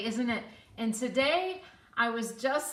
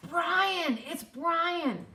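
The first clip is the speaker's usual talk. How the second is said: high, louder, drawn name; acted-out eager reveal; excited self-introduction; 7 semitones higher, 4 dB louder, much slower than usual. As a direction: announce yourself to a long-lost acquaintance. Stranger announces himself, retold.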